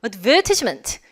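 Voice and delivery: "advertisement" as ad-VER-tis-ment, crisp t; British "advertisement" with a clear t after VER; British pronunciation. Shown as a demonstration. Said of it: "영국 발음".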